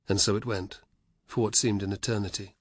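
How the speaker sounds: plain reading voice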